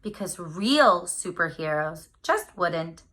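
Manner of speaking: plain reading voice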